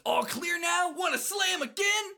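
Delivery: very high, eager invitation; high excitable cartoon voice; Shooter. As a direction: pitch it way up, raring to go. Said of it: "Shooter says".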